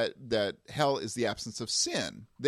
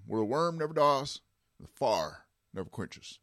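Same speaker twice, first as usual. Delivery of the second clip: put-on Texas preacher accent. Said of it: "the accent's there deliberately".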